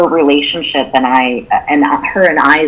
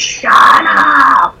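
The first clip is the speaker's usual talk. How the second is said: loud, high yell of a man at the end of his rope; retold scream; 12 semitones higher, 4 dB louder than usual. Mother retelling her husband snapping at their crying baby, screamed. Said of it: "he just screamed".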